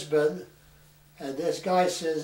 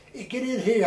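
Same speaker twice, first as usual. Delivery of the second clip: excited greeting; 7 semitones higher than usual. Retold or imitated. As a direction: call out gladly to a long-gone relative. Brother-in-law offers ride eagerly.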